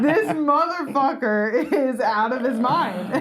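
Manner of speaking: high, drawn-out mock disbelief; incredulous. Comic telling a friend about the nosy dog-park guy, incredulous. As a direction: stretch it out in amused outrage. Retells reacting to nosy stranger.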